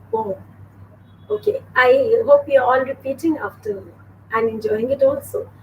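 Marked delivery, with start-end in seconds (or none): 0.1-0.4 poem in two syllables, "po-em"; poem with a clear long O, then "em"; correct pronunciation. Shown as a demonstration. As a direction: model poem as PO-em. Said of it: "Two syllables".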